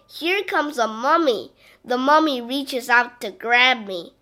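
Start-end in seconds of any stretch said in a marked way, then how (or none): none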